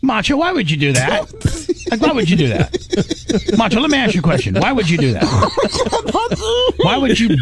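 pleading why-on-earth reproach; exasperated scolding. Real, not acted. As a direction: scold him in exasperated disbelief. Host scolds contestant for burping on air.

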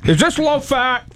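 very high mock question; high silly voice. Shown as a demonstration. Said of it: "That's the way you ended".

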